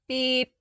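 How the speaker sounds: sharp high electronic beep; insistent datapad beep; imitated beep. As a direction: chirp it like a datapad alarm. Datapad alarm repeats.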